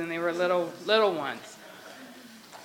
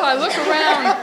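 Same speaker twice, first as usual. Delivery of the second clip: ordinary talk, nothing marked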